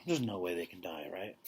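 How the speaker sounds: worried whispered aside. Real, not acted. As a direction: breathe it softly to the listener. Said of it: "aside to listener, whispered".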